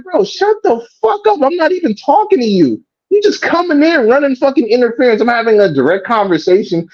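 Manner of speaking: high, heated telling-off; retold angry outburst. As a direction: snap at the guy butting in. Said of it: "I'm like".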